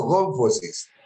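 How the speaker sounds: "gonfosis" más grave, con el acento en GON; acento en la primera O. Shown as a demonstration. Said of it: "La tilde va en la primera O". ("gonfosis" en tono descendente, tónica en la primera O)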